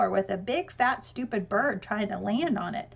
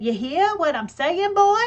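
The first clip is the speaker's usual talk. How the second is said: put-on boy parrots dad's Southern hillbilly accent, louder and higher; copying the hillbilly voice; 7 semitones higher, 8 dB louder than usual. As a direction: kid imitating dad's mock hillbilly accent. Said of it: "I said".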